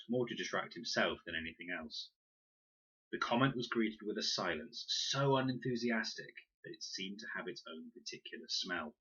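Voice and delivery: plain reading voice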